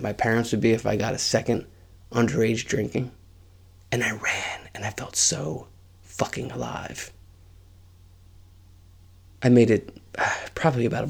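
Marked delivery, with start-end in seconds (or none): 3.9-7.1 hushed breathy whisper. Real, not acted. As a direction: whisper it like a thrilling secret. Reliving the escape, hushed.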